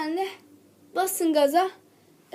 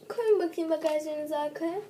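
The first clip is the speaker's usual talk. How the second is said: normal talking voice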